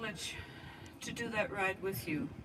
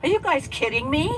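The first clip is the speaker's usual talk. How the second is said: higher, louder incredulous cry; mock disbelief; 8 semitones higher, 15 dB louder than usual. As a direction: imitate her shocked at the wild books. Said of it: "She'd say".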